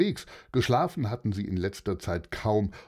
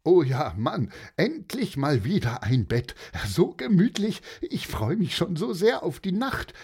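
higher, eager character voice, lightly hammed up; delighted relief; 6 semitones higher than usual. Weary traveller looks forward to a real bed, acted excited delight. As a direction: burst out with happy anticipation, pitch jumping high. Tired Ardalan rejoices at a bed.

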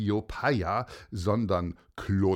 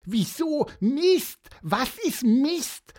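puzzled Pieper; 14 semitones higher, 5 dB louder, slower than usual. Acted.

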